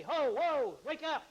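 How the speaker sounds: loud, high call to rouse a sleeper; waking shout. Acted. Guy comes in to wake a sleeper, shouting. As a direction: holler it out like someone rousing a sleeper. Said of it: "a guy that would come in and say".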